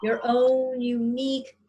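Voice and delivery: slow, calm meditation voice; guided meditation. Acted. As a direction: gently guide their breathing. Leads a breathing meditation.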